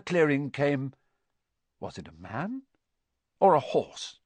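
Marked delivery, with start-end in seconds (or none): none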